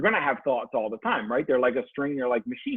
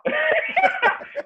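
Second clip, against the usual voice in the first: real laugh. Real, not acted. Husband amused at his own touchy thought, high laugh. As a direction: crack up at your own admission.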